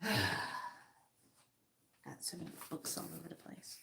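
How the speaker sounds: fast whispered aside to herself; muttered whisper. Real, not acted. Whispering to herself while hunting for something on the desk. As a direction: mutter it under your breath, quickly.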